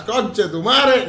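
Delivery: loud, high, drawn-out catchphrase yell; exclaimed swear. Pretend. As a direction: belt the curse out slowly as a running gag. Mock-swearing catchphrase yelled mid-intro.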